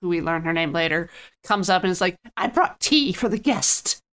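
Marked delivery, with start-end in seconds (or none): 2.4-4.0 slow, sweetly innocent maid. Retold or imitated. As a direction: play it falsely innocent. Voicing suspicious maid.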